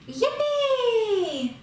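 high, loud gleeful shout of yippee; a bogey's joyful yell as he jumps. Acted. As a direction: whoop it out with delight.

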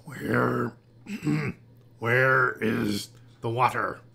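slow, raspy hatchling with a throat-clear; small raspy voice. A narrator's reading. Newborn creature asks the frogs for water, small raspy voice. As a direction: rasp it out slowly, clearing the throat. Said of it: "said in a small, raspy voice".

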